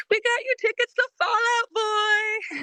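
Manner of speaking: parents' voice. Retold or imitated.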